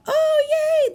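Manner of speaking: high, loud yay; excited cheer. Pretend. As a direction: cheer for the waking bug. Ladybug woke up.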